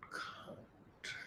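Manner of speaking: very softly sounded out word; barely audible self-check; muttered to self. Real, not acted. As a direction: try the word under your breath.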